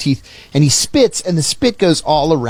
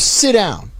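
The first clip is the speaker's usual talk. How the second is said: curt command; 7 semitones higher than usual. Retold or imitated. Boss commands nervous visitor.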